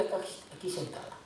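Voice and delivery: fast, then dropping to a whisper on aquí sentada; softly aside. Real, not acted. Preacher pointing out his wife in the pews, trailing to a whisper. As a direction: tuck the last words away.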